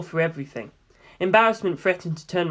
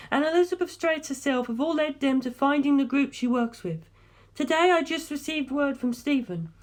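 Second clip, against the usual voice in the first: put-on young woman, higher than the narration, steady and serious; Darlington's voice; 9 semitones higher than usual. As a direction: lay out the findings calmly, like a briefing. Darlington explains the family's plan.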